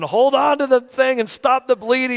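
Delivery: high, loud urgent yelling; acted-out dad in a panic; frantic orders. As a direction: shout instructions in an emergency. Retells frantic orders to his son.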